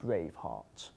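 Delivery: low, soft, falling repeat of the name; low, hushed dismay; deadpan disbelief. Acted. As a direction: drop the name quietly, unimpressed. Narrator repeats the silly name.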